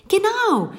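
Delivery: high, loud burst of agreement; excited. A narrator's reading. Girl confirming Titus's guess, excited. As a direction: jump on it, pitched right up. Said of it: "sie nickte aufgeregt".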